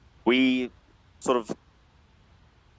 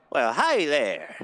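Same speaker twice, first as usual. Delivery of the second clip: bartender voice; 4 semitones higher than usual. Acted.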